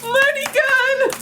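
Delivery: real giddy squeal of thanks; squealing delight. Real, not acted. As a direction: shriek with joy at the gift. Squealing at a big gift.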